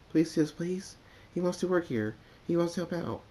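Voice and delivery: high, eager little-sister voice, pleading; pleading little filly. Acted. Sweet Belle figure pleads.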